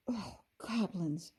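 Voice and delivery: soft, slow shudder of dread. A narrator's reading. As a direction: recoil from the thought of goblins. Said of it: "Ruby shuddered".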